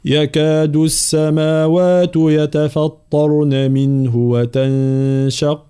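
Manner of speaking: slow, drawn-out tajweed chant; Quran recitation. Acted. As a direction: recite each word slowly and melodically. Teacher recites. (تلاوة مجودة بطيئة)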